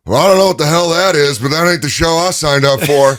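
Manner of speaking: blunt cowboy dismissal; Stone Cold impression. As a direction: Austin scoffing at a bombed pitch, loud. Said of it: "you just hear Steve go".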